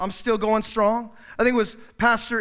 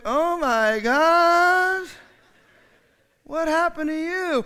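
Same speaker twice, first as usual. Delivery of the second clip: mock shocked kids; 7 semitones higher, much slower than usual. Kids react to angry parent.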